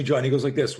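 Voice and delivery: usual speaking voice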